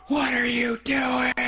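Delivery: high, slow, shrieking yell; old lady screaming. Retold or imitated. Angry old lady yells at kids catching turtles, high slow scream. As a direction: scream it like a furious old neighbour. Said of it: "screaming at us at the top of her lungs".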